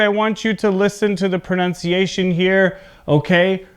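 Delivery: ordinary delivery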